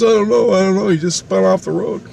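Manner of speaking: high, bewildered retelling; flustered answer. Retold or imitated. Relative explaining the car spinning off, flustered.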